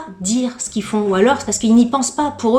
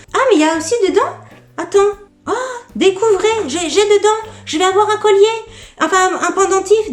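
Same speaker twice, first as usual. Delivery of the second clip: high, thrilled gasp of discovery; delighted surprise; 10 semitones higher, faster than usual. Real, not acted. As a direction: react to an unexpected gift, pitch shooting up.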